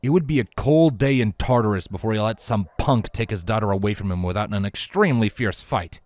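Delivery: usual speaking voice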